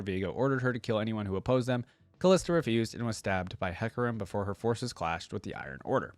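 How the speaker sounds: usual speaking voice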